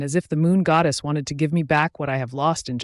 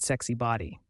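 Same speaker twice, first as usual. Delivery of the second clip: usual speaking voice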